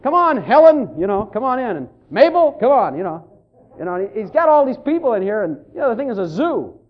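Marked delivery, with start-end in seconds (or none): 0.0-2.9 loud cheerful welcome to guests; hearty calling in. Retold or imitated. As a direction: wave them in, happy and loud. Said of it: "and Matthew is".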